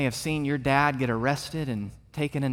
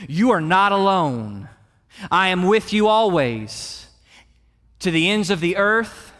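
raised, forceful pulpit voice; emphatic sermon delivery, pitched up; impassioned preaching; 5 semitones higher, 7 dB louder than usual. Acted. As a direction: preach it out with conviction to the congregation.

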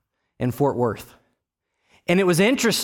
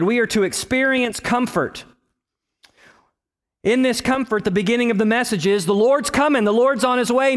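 preaching; 8 semitones higher than usual. Acted. Preacher urging the congregation, high and fervent. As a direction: call the people to get ready.

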